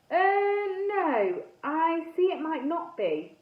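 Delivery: high, drawn-out helpful tone; best helpful voice. A narrator's reading. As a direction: sound eagerly helpful, stretching the words. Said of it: "in my best helpful voice".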